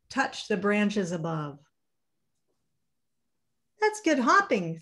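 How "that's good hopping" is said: high, impressed; little hare admiring, pitched up. Acted. Little hare watching his father, high admiring voice. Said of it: "thought Little Nut Brown Hare".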